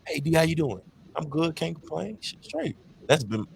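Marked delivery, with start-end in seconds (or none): 0.1-0.8 friend's greeting. Retold or imitated. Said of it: "most of my greetings". 1.2-2.8 higher, light "all good" brush-off; breezy stock reply. Retold or imitated. To a friend asking how he is, a breezy higher brush-off. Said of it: "most of my greetings".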